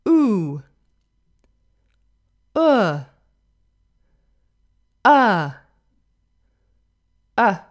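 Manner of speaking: isolated vowels, each loud with falling pitch; repeat-after-me vowel drill. Shown as a demonstration. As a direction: model each vowel sound alone, long pauses between. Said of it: "Okay, so repeat after me again".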